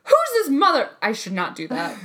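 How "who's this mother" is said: high, loud mock tough-guy snarl; Samuel L. Jackson. Imitating Samuel L. Jackson at her boss, loud and high. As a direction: ham up a movie badass cursing. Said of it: "Samuel L. Jackson comes out".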